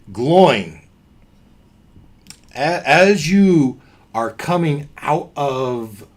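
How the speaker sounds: ordinary talk, nothing marked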